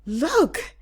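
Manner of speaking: ordinary delivery, nothing marked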